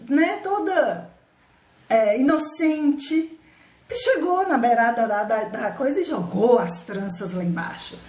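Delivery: usual speaking voice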